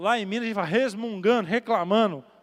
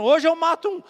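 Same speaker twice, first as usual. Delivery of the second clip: threatening yell; 8 semitones higher, 5 dB louder than usual. Retold or imitated. Repeats the drunk's threat.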